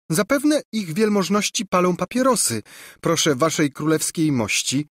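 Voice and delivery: put-on high, polite girl's voice; lightly acted servant girl; maidservant. A narrator's reading. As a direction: explain deferentially to the king. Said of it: "rzekła Ruzia".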